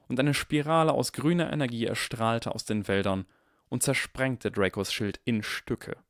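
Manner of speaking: plain reading voice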